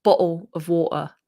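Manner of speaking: low-pitched London "Bottle of water" with glottal T; London accent, T replaced by glottal stops. Shown as a demonstration. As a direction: imitate a Londoner swallowing the T in "bottle". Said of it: "in London say".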